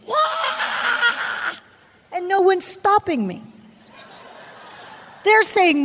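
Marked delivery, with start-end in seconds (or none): 0.1-1.5 retold scream. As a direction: imitate your own scream, pitched way up. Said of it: "I am stuck there screaming".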